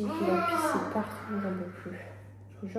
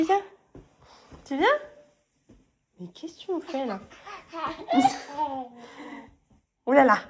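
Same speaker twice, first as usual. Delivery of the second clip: ordinary talk, nothing marked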